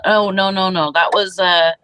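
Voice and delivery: ordinary talk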